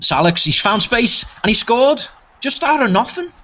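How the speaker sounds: high, rising burst of excitement; real commentator's thrill, pitch climbing; excited goal call. Real, not acted. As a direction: call the sudden goal as it happens.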